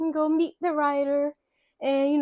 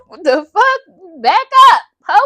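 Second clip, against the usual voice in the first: high, loud, slow What the fuck; hammed-up shouted brush-off; mock outrage; 6 semitones higher, 12 dB louder than usual. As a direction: shove her off loudly in jest. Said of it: "So he ain't say it like that".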